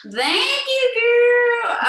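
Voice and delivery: high, loud gushing thank-you; excited thanks. Real, not acted. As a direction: gush your thanks to a friend. Cheerful thanks to member.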